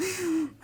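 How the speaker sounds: sharp high gasp of shock; mock gasp. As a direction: gasp as if hearing bad news. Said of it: "And I'm like".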